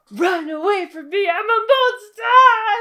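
high, loud, slowed mock villain cry; hammed-up warning. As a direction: overplay the tortured beast. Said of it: "he's like".

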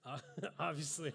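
real laugh breaking into the word. Real, not acted. Preacher laughs at his own drawing of Jesus, laughing. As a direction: try to talk through a laugh.

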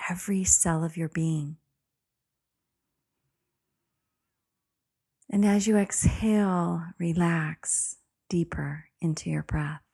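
calm meditative tone. Acted. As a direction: breathe it out gently, soothing. Leads a guided meditation.

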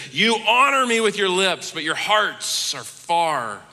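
loud, high start then slow, solemn close; Jesus rebuking. Acted. Jesus rebuking the Pharisees, stern then solemn. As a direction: rebuke them, then let it land slowly. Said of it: "he says".

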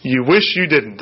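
ordinary talk

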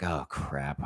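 low, soft inner groan; dismayed aside. Retold or imitated. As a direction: mutter it low to yourself. Inner dread while reading aloud.

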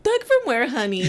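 mock him with a big sassy lift; sassy retort. Mocking the ex who claims he's a thug, high sassy comeback. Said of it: "And I was like".

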